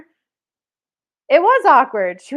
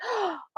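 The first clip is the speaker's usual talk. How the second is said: acted high gasp of recognition; surprised gasp; 9 semitones higher, 9 dB quieter than usual. Newcomer spotting the gentleman from before, surprised gasp. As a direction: suck in air in mock surprise.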